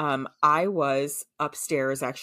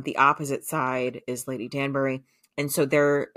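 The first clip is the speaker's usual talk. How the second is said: normal talking voice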